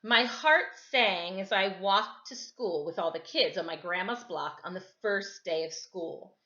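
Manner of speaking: ordinary delivery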